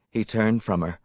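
ordinary delivery

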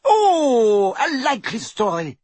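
high, mocking disbelief; scornful screech. A narrator's reading. Torturer dismissing her captive's excuse, scornful screech. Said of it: "screeched Bellatrix".

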